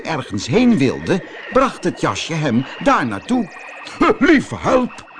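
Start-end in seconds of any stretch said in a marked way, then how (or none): 4.2-5.1 high startled exclamation, a touch louder; surprised cry. Acted. Professor startled as his jacket lifts him, high surprised cry. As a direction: gasp it out in alarm, pitched up. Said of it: "riep professor Potlood".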